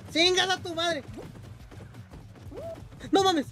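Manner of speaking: frantic shouting. Real, not acted. Streamer begging himself not to fall in lava, frantic. Near falling in lava level.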